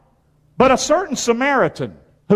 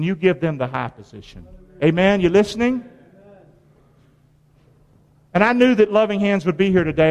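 ordinary talk, nothing marked

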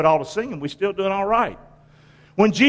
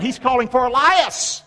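raised, higher call; crying out; 5 semitones higher than usual. Acted. Onlookers at the cross crying out, raised call. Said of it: "they cried out".

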